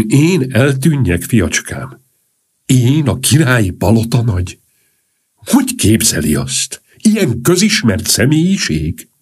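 slow, high-pitched offended grandee; haughty indignation. A narrator's reading. Count to Sandoval, asked to disappear, haughtily indignant. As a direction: draw it out with wounded self-importance.

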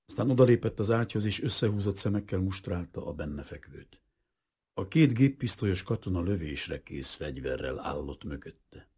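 plain reading voice

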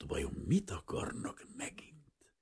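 whispered, slow and frightened; fearful whisper. Acted. Shopkeeper frightened at the knock, whispering. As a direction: hush it, scared of who knocks.

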